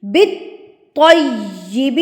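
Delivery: بالطیب said slowly as bittoyyib, pressing the doubled t; stressed shadda. Shown as a demonstration. Teacher correcting her students, بالطیب with a pressed t. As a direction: hit the t in بالطیب hard and skip the lam. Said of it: "زور سے".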